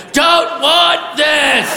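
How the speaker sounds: high, drawn-out dopey wail; incoherent blurt. Acted. Man in blurt mode faces a ticket, slow high wail. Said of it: "just walked up and went".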